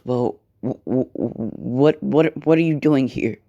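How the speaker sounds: acted stutter on the opening words; nervous stammer. Nezu confronted by Deku in his office, nervous stammer. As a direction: stumble nervously into the question. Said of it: "he smiles nervously".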